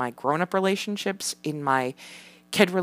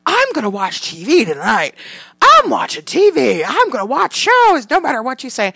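higher, louder bratty insistence; defiant kid; 8 semitones higher, 8 dB louder than usual. Retold or imitated. Mother mimics son insisting on TV, loud bratty kid. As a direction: stamp your foot like a defiant five-year-old.

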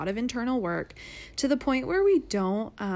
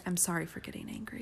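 hushed, quick little apology; whispered apology; 3 semitones lower, 8 dB quieter, faster than usual. Retold or imitated. Husband quietly apologizing to daughter, whispered. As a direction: whisper it fast, almost ashamed. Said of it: "And you said".

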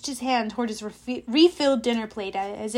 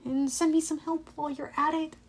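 softer, higher inward plea; tentative request under the breath; silent prayer; 4 semitones higher, 6 dB quieter than usual. A narrator's reading. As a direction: pray it privately. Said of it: "I prayed silently".

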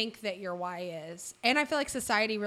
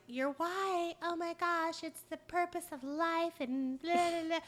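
higher, mocking whiny voice; mocking critic; 8 semitones higher than usual. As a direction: sneer like a snobby critic. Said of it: "anybody who's like".

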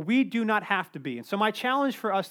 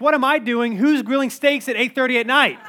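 indignant retort; 4 semitones higher, 8 dB louder than usual. Retold or imitated. Kid's imagined comeback, heated.

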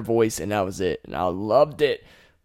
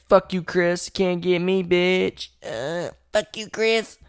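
mock taunt; 7 semitones higher, slower than usual. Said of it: "And you're like".

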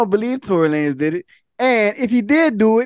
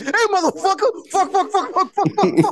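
high-pitched rapid-fire comic swearing; mock cursing outburst; 9 semitones higher than usual. Impersonating the friend's old way with a kid, mock cursing outburst. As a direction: spit out a hammed-up string of curses. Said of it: "the old D J True would be like".

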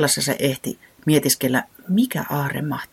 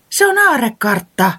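higher, louder cry of discovery; excited exclamation; 9 semitones higher, 6 dB louder than usual. A narrator's reading. Little chick spots a treasure map, higher and louder exclamation. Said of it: "Tiputii huudahti".

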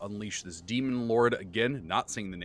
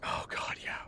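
whispered aside; 7 dB quieter than usual. Real, not acted. Whispered aside after laughter.

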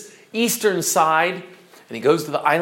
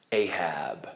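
much lower and softer, a dark warning; ominous hush; 11 semitones lower, 9 dB quieter than usual. Acted. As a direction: let the name drop like a curse. Stranger names the dread captain.

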